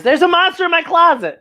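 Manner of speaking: put-on high, worried child's voice; little girl voice. Young Buffy telling the librarian about the monster, high and worried. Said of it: "And Buffy says".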